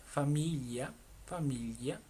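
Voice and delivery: familia with a palatal ly, fa-MEE-lya; Italian GLI sound. Shown as a demonstration. Teacher models the hard Italian sound for learners, careful pronunciation. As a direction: melt the l and i of familia into one sound. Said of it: "La G L I se pronuncia yi".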